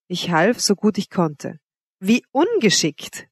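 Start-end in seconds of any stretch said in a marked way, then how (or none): 2.0-3.3 slow, high, scornful "Wie ungeschickt"; clumsy servant scolded by his mistress, slow and scornful; scornful exclaim. A narrator's reading. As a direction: drag it out, mocking his clumsiness. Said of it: "rief sie".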